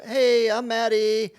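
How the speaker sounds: mock teen vlogger pitch; influencer intro. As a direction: play the girl greeting her viewers. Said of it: "she does video two".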